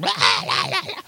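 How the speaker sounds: stuttering imitated wail; mock screaming. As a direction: babble out a childish scream. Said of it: "we're, like, yelling and screaming".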